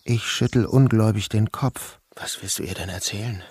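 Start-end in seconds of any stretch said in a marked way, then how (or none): 2.1-3.4 hushed disbelief. Acted. Incredulous reply to threat.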